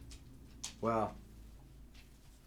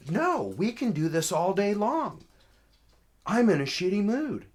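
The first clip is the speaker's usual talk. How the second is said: high, loud retold comeback; defiant; 10 semitones higher, 8 dB louder than usual. Caller refuses to back down to officer, defiant. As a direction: dig in with raised voice.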